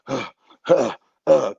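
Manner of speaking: short panting gasps, struggling for air; gasping for breath. Retold or imitated. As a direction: act out being unable to breathe. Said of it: "couldn't, couldn't catch my breath".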